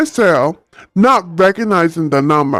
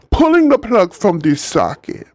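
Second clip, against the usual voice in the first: usual speaking voice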